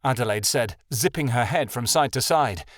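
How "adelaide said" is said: ordinary delivery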